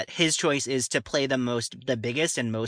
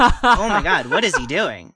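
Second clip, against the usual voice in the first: loud, laughing shock, pitched up; laughing disbelief; 5 semitones higher, 9 dB louder than usual. Retold or imitated. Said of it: "I was like".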